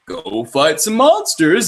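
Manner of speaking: drawn-out, hammed-up game command; slow mock-heroic call to action; mock game prompt. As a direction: send the player off with exaggerated, slow gusto. Voices the game sending player off.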